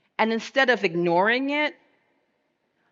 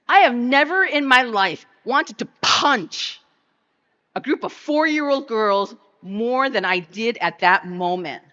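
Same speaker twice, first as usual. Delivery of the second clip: louder, higher, fed-up outburst; real anger; 4 semitones higher, 5 dB louder than usual. Real, not acted. Mother on stage, furious at girls who hurt her daughter, loud angry outburst. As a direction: let the fury rise through the line.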